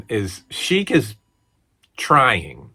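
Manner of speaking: normal talking voice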